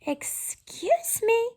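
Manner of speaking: a small creature clearing his throat to interrupt; polite cricket. Acted. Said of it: "coughed a cricket".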